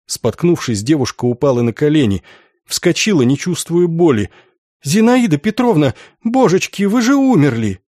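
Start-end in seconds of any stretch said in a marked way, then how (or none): 4.8-7.8 put-on high-pitched, breathless-with-shock girl; astonished exclamation. Girl meeting Zinaida Petrovna who had died, high astonished cry. Girl stunned to see dead woman.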